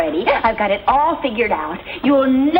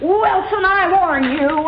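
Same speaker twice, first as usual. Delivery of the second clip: mock dad warning; 6 semitones higher, slower than usual. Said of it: "I'll pretend I'm Dad".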